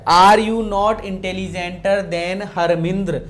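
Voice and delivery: reading the sentence out slowly for the class; slow dictation. Shown as a demonstration. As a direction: spell it out word by word, loud and slow. Teacher reads out test sentence.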